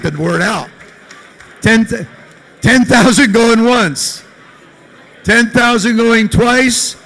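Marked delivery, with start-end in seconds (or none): 1.6-6.9 loud, slow going-once auctioneer call. Pretend. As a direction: drag out the going once, going twice. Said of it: "I'm not an auctioneer".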